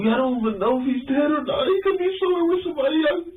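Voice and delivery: high, rushed tearful protest; crying. Real, not acted. Suspect protesting to detective, crying high and fast. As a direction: burst into crying denial. Accused of murder, breaks down.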